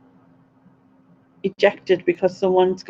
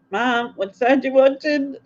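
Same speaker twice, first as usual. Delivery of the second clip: higher, weary exasperation; exasperated question; 6 semitones higher than usual. Retold or imitated. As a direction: ask it fed up for the third time. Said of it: "And I'm like".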